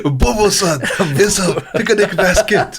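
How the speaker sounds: high cartoonish Japanese accent; exaggerated Japanese accent, laughing. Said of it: "a racist accent".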